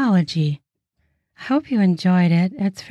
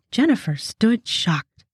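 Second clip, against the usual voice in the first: ordinary delivery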